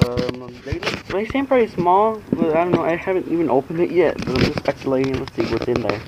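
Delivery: ordinary talk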